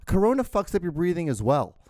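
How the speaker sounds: ordinary talk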